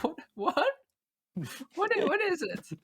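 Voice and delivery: high, bewildered questioning; baffled. Acted. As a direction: play startled confusion. Acted confusion at the scene.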